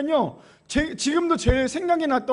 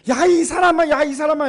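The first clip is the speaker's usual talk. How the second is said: calling out a foolish man in disbelief; exasperated rebuke; 2 semitones higher, 5 dB louder than usual. Shown as a demonstration. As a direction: scold someone for an absurd request. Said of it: "이렇게 얘기하잖아요".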